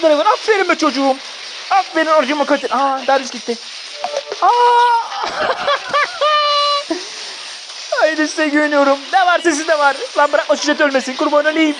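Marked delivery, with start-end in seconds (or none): 4.5-7.9 shrieking laugh. Real, not acted. Triumphant laugh after fight.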